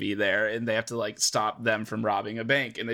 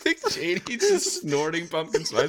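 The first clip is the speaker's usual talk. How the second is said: laughing; 6 semitones higher, 4 dB louder than usual. Real, not acted. Joking about Dick Cheney.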